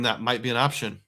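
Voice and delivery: ordinary talk, nothing marked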